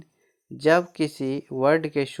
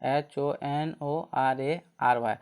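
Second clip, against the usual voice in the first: ordinary talk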